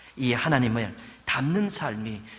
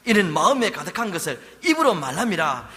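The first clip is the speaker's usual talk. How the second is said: stern rebuke; 4 semitones higher, 6 dB louder than usual. Acted. Preacher voicing Jesus rebuking.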